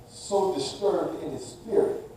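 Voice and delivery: soft take. Shown as a demonstration. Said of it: "repeated for emphasis".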